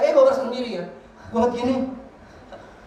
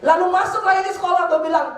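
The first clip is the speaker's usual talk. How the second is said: ordinary talk, nothing marked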